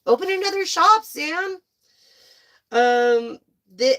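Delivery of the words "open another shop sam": excited urging. Real, not acted. Urging viewer Sam.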